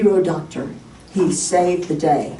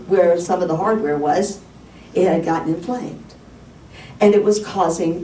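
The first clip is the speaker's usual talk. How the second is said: ordinary talk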